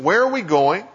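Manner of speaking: ordinary talk, nothing marked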